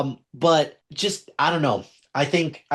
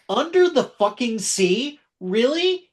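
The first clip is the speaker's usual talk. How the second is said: high-pitched indignant disbelief; incredulous; 9 semitones higher than usual. Real, not acted. Host appalled at a snubbed Little Mermaid song, incredulous. Ranting about an Oscar nomination snub.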